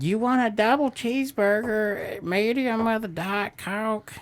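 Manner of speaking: high, slow, spaced-out cashier voice; mocking the cashier. Scene: mocking the McDonald's cashier taking his order, high and slow. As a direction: play a zoned-out drive-thru worker, drawn out. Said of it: "the meth head that was taking my order said".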